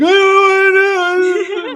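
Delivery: high, loud panicked wailing; panicked girlfriend voice. Retold or imitated. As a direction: squeal it in a panic.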